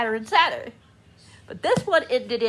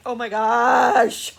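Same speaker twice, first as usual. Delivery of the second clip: amazed; 7 dB louder, much slower than usual. Retold or imitated. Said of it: "I'm like".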